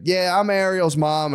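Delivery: put-on high, slow, proud little-kid voice; imitated young daughter. Daughter piping up at bedtime as Ariel's mom, childlike. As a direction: imitate a child announcing her pretend role. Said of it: "out of nowhere, she's like".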